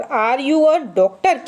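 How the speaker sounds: slow, careful example sentence; teacher reading an example slowly; slow read-aloud. Shown as a demonstration. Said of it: "जैसे number four".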